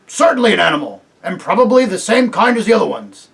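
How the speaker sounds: loud, gruff-voiced bulldog. A narrator's reading. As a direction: bark out the discovery.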